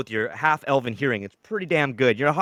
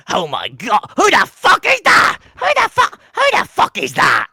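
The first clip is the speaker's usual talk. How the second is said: high, loud panicked shrieks of a goblin; 8 semitones higher, 7 dB louder than usual. Acted. Goblins spotting the hero in the sky, panicked screech. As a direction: squeal it out like a terrified goblin. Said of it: "the goblins below are like".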